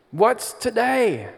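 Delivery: put-on high, slow British accent as Scrooge; Muppet Scrooge British accent. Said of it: "Oh no, my accent".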